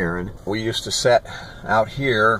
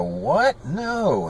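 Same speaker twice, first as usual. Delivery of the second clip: higher, disbelieving no; shocked objection; 6 semitones higher than usual. Retold or imitated. Boy hears the cat will be killed, shocked. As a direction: retell a shocked protest. Horrified reply to killing a cat.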